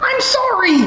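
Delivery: high panicked squeak. Acted. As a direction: blurt it out in panic. Panicked apology to teacher.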